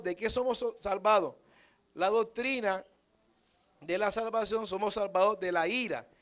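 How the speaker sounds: loud, emphatic pulpit preaching; full preaching. Acted. Preacher drives home what salvation means to the congregation, loud and forceful. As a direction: proclaim it hard to the whole church.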